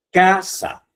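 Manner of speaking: "casa" with CA stretched and pitched high; teacher demonstrating the stressed CA in "casa"; stress on first syllable. Shown as a demonstration. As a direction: punch the CA of "casa", let "sa" fall away. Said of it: "ahí se escucha que hay más, eh, hay una entonación más fuerte".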